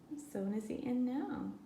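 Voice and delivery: ordinary delivery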